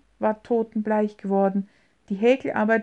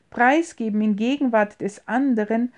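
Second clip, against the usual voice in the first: usual speaking voice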